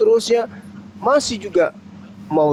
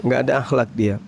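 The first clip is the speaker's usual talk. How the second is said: ordinary talk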